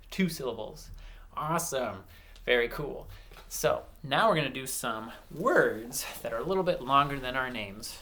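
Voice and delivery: normal talking voice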